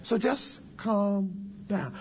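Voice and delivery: very slow, drawn-out and soothing; unhurried calm. Retold or imitated. Preacher slowing an upset caller, calm and drawn out. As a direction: stretch it out to settle someone. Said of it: "I'm not gonna get upset".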